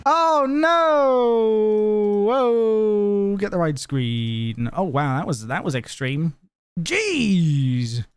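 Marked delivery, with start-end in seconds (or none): 0.1-3.4 drawn-out, higher "oh no"; long wailing cry. Real, not acted. Streamer reacting to an extreme mishap, long wail. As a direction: wail it out in dismay. Sudden on-screen mishap, cries out.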